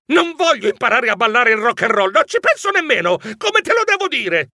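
high, rising outburst of protest; exasperated shout. A narrator's reading. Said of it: "Io gridai esasperato".

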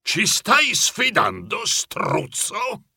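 high, drawn-out indignant question; bristling old man, rising at the end; challenging grandpa. A narrator's reading. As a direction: dare him to say it. Grandpa challenges the boastful neighbour.

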